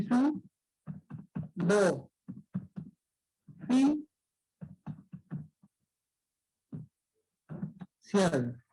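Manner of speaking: "su, do, fi, cial" separated by long pauses; syllable by syllable. Shown as a demonstration. Teacher splitting suboficial into syllables, slowly. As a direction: sound out suboficial piece by piece. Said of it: "Entonces sería así".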